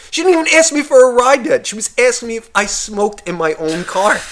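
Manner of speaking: high-pitched, incredulous complaint; indignant. Real, not acted. Man telling of a presumptuous passenger's car rules, indignant. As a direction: protest the nerve of it, voice climbing high.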